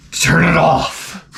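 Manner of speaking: slow, strained mock-agonized groan; George C. Scott. Father watching his daughter's film, slow anguished groan. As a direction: drag it out like a monster awakening. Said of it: "he's turning into Frankenstein's monster".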